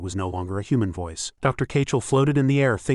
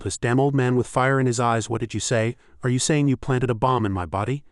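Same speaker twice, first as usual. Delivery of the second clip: ordinary delivery, nothing marked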